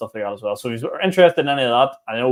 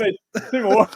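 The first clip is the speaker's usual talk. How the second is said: laughing mock heckle; 8 semitones higher, 3 dB louder than usual. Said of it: "No one's going".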